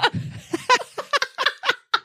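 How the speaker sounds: high burst of laughing; laughter. Real, not acted. Laughs at own joke.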